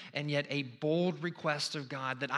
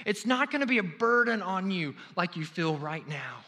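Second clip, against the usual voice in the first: normal talking voice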